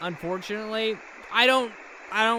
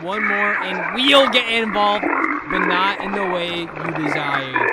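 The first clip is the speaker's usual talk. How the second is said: slow, deliberate reading with a threat that swells louder; menacing message read; 3 dB louder than usual. Acted. Streamer voicing the enemy's transmission to viewers, building to a slow, loud threat. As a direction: read it out like an ominous warning from a rival power.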